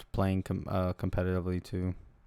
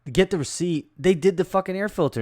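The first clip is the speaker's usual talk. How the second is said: loud, higher angry telling; heated; 7 semitones higher, 5 dB louder than usual. Real, not acted. Telling a friend about the shop, heated and loud. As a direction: vent it hot and loud. Venting about being overcharged.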